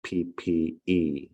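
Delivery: slow letter-by-letter spelling; spelling out slowly. Shown as a demonstration. Author spelling the word lagniappe for listeners, slow. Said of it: "word called lagniappe".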